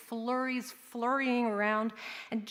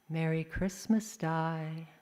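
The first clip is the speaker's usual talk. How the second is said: low, soft greeting; gentle; 4 semitones lower, 4 dB quieter, slower than usual. Acted. Mom waking child on Christmas morning, gentle and soft. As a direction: wake a child tenderly.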